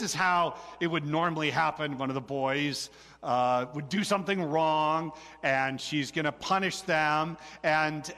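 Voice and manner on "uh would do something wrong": ordinary talk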